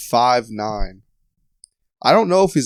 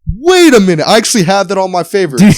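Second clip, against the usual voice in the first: rising higher and louder, amazed; excited surprise; 10 semitones higher, 12 dB louder than usual. Real, not acted. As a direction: build from surprise to excited shout. Recognizes band name excitedly.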